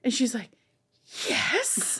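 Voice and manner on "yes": put-on high childlike yes; a small girl thrilled, high; eager little girl. Her four-year-old self answering, a high eager child.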